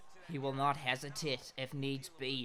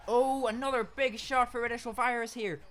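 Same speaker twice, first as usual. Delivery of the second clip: high, loud play-by-play yell; excited shout; 9 semitones higher, 7 dB louder than usual. Real, not acted. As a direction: call the hit with a burst of excitement. Excited fight commentary.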